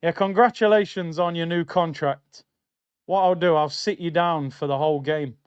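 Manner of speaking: normal talking voice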